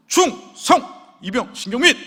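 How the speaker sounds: high, loud military salute; crisp parade-ground yell; brave recruit salute. Retold or imitated. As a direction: shout it like an eager new private. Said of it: "뭐 이거보다 더 용맹하게 합니다".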